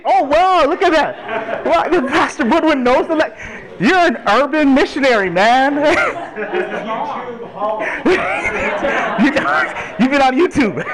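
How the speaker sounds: delighted laughing tease; laughing surprise. Real, not acted.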